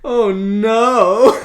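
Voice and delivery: high, loud dramatic cry; mock dismay. Host reacting to the guilt line, mock dismay. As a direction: wail it in exaggerated despair.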